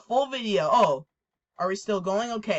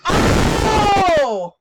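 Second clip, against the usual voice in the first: loud, high startled yell; startled shout; 11 semitones higher, 11 dB louder than usual. Real, not acted. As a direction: jump out of your skin. Jump scare hits.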